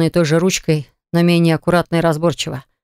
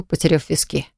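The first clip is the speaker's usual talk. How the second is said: plain reading voice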